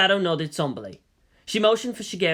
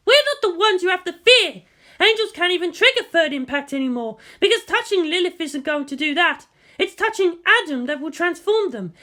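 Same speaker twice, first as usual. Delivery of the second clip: higher, sharp, combative officer; Misato's voice; 14 semitones higher, 4 dB louder than usual. A narrator's reading. Misato snapping at the general, higher and defiant. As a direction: push back hard, voice pitched up.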